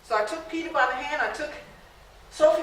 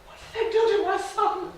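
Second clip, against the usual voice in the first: a raised, stricken plea; anguished question; 7 semitones higher than usual. Acted. Mother seeing her starved son, anguished and raised. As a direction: cry it out in disbelief.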